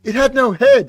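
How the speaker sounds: high, loud goofy defence; imitating a clueless character excusing himself; hammed-up high silly voice; dopey excuse. Acted movie quote, deadpan answer.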